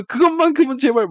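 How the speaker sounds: high-pitched dramatic begging; Lee Byung-hun impression. Protagonist begs for his test paper, hammed-up actor impression. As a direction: plead like an overwrought film actor. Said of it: "이병헌이 빙의가 돼 가지고".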